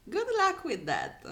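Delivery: high, loud scoff; mocking "good luck"; sarcastic retort. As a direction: throw it back with scorn.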